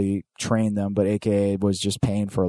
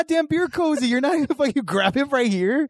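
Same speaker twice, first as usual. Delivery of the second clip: high incredulous ribbing; mocking. Real, not acted. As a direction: scoff at him way up high. Mocking friend's beer cozy.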